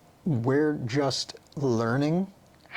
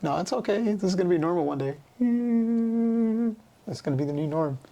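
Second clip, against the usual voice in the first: higher, bright reassurance to herself; reassuring; 7 semitones higher, faster than usual. Retold or imitated. Brushing with the wrong hand, reassuring herself, pitched higher. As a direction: coax yourself through the awkwardness. Reassuring self while odd-handed.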